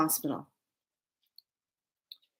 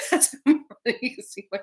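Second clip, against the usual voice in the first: high, quick, laughing words; cracking up at your own story; 6 semitones higher, faster than usual. Real, not acted. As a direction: talk through real laughter. Laughing at bare-backed running.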